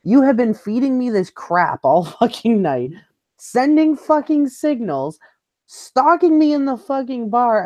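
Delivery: high, drawn-out exasperated tirade; retold losing it. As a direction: re-enact the outburst, pitch climbing and words stretched out. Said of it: "and I lose my shit".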